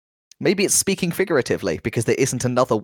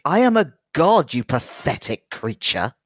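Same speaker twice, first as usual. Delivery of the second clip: haughty monster proclamation; 3 semitones higher, slower than usual. Acted. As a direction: proclaim it slowly, then sink into contempt. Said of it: "The aboleth says in all of your heads".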